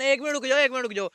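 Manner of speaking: shouting at the others to wait; urgent call. Real, not acted. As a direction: halt them fast.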